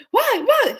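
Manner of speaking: high, loud yelps; mock startled. Husband falling out of chair, mock yelp.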